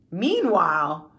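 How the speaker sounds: ordinary talk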